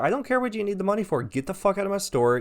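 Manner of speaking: high, fast exasperated rebuke; retold angry dismissal. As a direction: re-enact throwing him out, pitch shooting up. Angry refusal to the man, retold.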